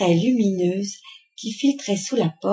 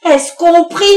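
loud, high, angry command; irate ultimatum building to a shout; threatening order; 5 semitones higher, 7 dB louder than usual. A narrator's reading. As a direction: lay down the law to your husband. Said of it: "avec un geste menaçant".

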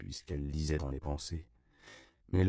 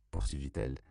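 ordinary delivery, nothing marked

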